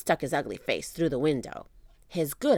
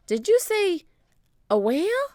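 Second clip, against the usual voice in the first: higher, louder double take; surprised; 14 semitones higher, 4 dB louder than usual. Acted. Jack asks Annie, high and surprised. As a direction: catch up in disbelief.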